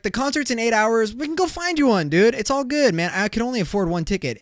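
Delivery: brushing-off dad. Pretend. Dad fobs off his kid, high-pitched breezy excuses. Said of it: "And I'll be like".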